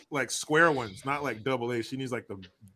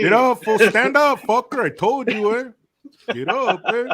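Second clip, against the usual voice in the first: high, loud pretend telling-off; raised, hammed-up scolding voice; mock girlfriend scolding; 8 semitones higher, 6 dB louder than usual. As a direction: ham up an indignant girlfriend. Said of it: "She was like".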